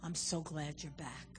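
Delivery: soft, tender repeated I love you; loving God. Retold or imitated. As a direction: welcome the prodigal home gently. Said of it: "God says".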